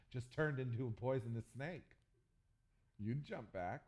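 normal talking voice

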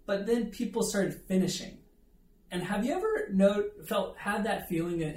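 ordinary talk, nothing marked

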